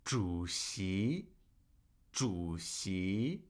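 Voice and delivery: 主席 drawn out low, 主 dipping and 席 rising; tone demonstration. Shown as a demonstration. Teacher drilling a word for students, 主席 in low third tone then rising second. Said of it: "Third tone, second tone".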